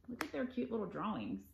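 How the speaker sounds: ordinary delivery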